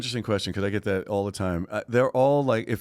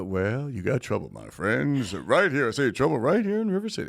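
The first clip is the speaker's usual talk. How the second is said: showman's musical patter; 3 semitones higher than usual. Acted. Guest pitching himself with a show number, showman's musical patter. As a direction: build it like a musical-theater huckster warning the town. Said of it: "I did that show".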